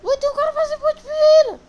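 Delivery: high, fast startled yell; excited shout. Real, not acted. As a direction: blurt it out, shocked and loud. Startled at another player.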